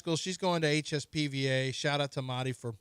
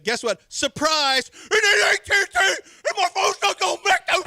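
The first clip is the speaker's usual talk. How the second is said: mock wailing shout; 10 dB louder than usual. Mock fake cheerful then wail.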